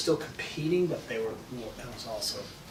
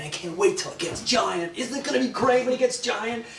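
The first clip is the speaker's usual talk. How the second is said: higher and higher eager voice; eager friend; 11 semitones higher, 4 dB louder than usual. Retold or imitated. Surf partner eagerly anticipating giant waves, rising excited voice. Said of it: "he'd be go".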